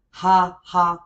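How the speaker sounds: the English ha, ha, h breathed out. Shown as a demonstration. Ha, ha explaining Spanish laughter, breathy h. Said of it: "spelled H A H A".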